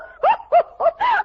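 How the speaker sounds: high giggling laugh; delighted scheming giggle; gleeful laugh. Acted. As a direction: cackle with glee at the plan. Maid gloats over her scheme.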